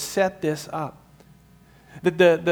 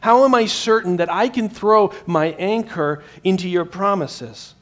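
urgent cry; 4 semitones higher, 7 dB louder than usual. Retold or imitated. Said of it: "we ask that question".

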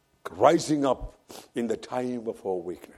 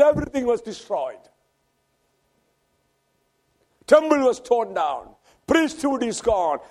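raised, ringing preacher's voice; preaching at full force; 10 semitones higher, 6 dB louder than usual. Acted. Preacher retelling Elijah's flight to the congregation, preaching at full force. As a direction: proclaim it to the congregation.

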